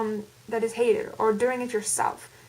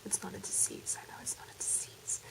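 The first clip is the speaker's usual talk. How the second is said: whispered aside; 3 semitones lower, 15 dB quieter than usual. Real, not acted. Muttered aside correcting her own joke.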